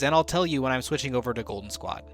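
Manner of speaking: normal talking voice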